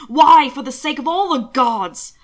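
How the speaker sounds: loud exasperated cry to the heavens; frantic shout. Acted. Husband demanding why Will hid danger, a frantic shout. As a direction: throw the question up in alarm. Hannibal panicked over attempts on Will.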